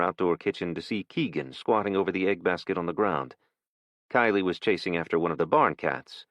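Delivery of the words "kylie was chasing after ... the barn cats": ordinary delivery, nothing marked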